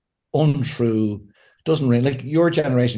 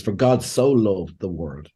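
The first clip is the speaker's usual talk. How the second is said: scripture recitation; 5 semitones lower than usual. Acted. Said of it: "it says".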